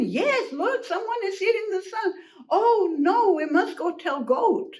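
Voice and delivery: high, flustered outburst; alarmed pig. Acted. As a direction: exclaim in storybook panic. Pig panics.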